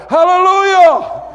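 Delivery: high, loud burst of praise; shouted hallelujah. Real, not acted. Preacher bursting out to the congregation, high loud shout. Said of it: "I almost want to shout it".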